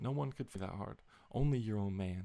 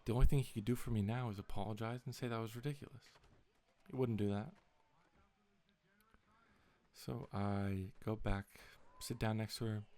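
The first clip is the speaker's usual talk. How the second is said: usual speaking voice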